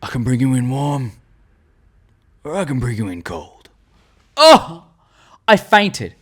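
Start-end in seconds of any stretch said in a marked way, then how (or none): 0.0-3.5 low, hushed tough-guy line, measured pauses; Mandalorian. Retold or imitated. Host quotes the show's opening line, Mandalorian impression. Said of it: "And then the opening line". 4.4-4.8 high, loud exclaimed oh; mock swoon. Fan hears the opening line, mock swoon.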